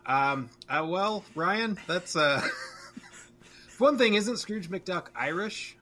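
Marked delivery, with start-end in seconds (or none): none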